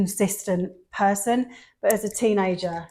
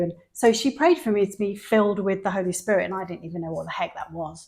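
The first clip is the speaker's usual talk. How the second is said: ordinary talk, nothing marked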